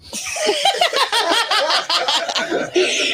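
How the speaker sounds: long, high cackling laugh; high cackle. Pretend. Host jokes about keeping tricks guessing, long high cackle.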